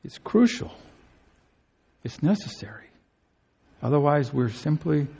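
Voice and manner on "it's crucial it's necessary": usual speaking voice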